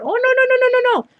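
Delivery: very high mock-panicked chorus; hammed-up high-pitched objection; imitated panicked technicians. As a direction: squeal rapid no's, mimicking both of them. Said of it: "The other two gals are".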